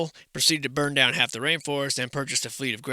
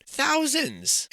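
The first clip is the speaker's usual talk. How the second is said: incredulous exclamation; 12 semitones higher than usual. Real, not acted.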